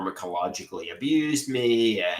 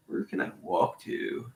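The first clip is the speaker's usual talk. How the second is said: slow unvoiced whisper; Where can I walk to whispered slowly; 7 dB quieter than usual. Real, not acted. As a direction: murmur it to yourself with no voice. Repeats line as whisper.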